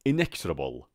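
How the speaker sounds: "inexorable" as "in-EKS-ra-bol", stress on EKS; repeat-after-me model. Shown as a demonstration. Teacher asking students to repeat after her, "inexorable" with ks. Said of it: "پشت سر من بگید".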